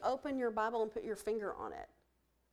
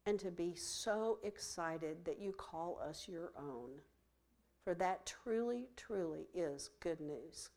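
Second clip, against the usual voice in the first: closing prayer. Acted. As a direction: pray over them gently, letting lines fall away.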